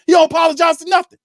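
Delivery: fast, loud, rising fury; exploding at the coach who never apologizes; angry shout. Real, not acted. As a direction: yell it out, building fast. Furious fan ranting about coach.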